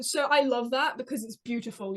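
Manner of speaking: ordinary delivery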